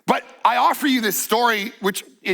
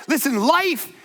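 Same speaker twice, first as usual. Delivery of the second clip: building sermon appeal, higher; preaching; 5 semitones higher than usual. Acted. Preacher urging the congregation, rising intensity. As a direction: press the point home to the congregation.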